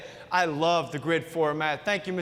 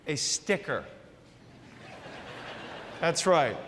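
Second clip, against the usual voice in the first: soft, low letdown after a pause; underwhelmed, lowered punchline; deadpan reveal; 3 semitones lower, 4 dB quieter than usual. Acted. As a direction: drop the prize in quietly and dryly. Anticlimactic reveal of the prize.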